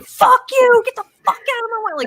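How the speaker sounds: high-pitched yell at other drivers, then rushed; imitated road rage. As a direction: re-enact your own road-rage outburst. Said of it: "then I get behind the driver's seat".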